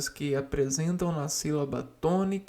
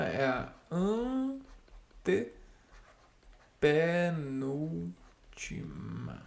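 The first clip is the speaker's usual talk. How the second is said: antepenúltima stretched very slowly, falling at the end; drawn-out key word; 3 dB quieter, much slower than usual. Shown as a demonstration. Teacher correcting the class, slowly stretching the answer. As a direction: linger on antepenúltima to make it stick. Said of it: "não é a última".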